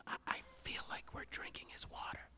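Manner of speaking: guilty hushed whisper. Retold or imitated. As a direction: whisper it so the driver won't hear. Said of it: "and whispers".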